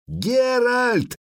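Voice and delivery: high, drawn-out cry of the name; cried out. A narrator's reading. Ciri to Geralt, sensing danger behind her, crying out. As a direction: call out in alarm, pitched high. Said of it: "крикнула Цири".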